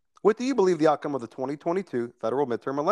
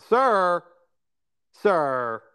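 Leslie Stahl voice; 4 semitones higher than usual. Retold or imitated. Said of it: "the Leslie Stahl voice".

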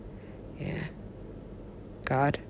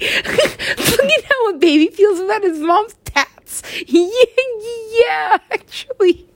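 high, slow tearful laughter; laugh-crying; 4 dB louder than usual. Real, not acted. Moved by the baby loving mom, high tearful laugh. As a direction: laugh through happy tears.

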